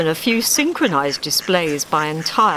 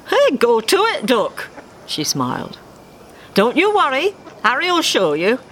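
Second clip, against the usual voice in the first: motherly duck, much higher and cheery; Ma's voice; 8 semitones higher than usual. Acted. Ma cheering on her son Dave, high and fond. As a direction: sing it out like a fond mum.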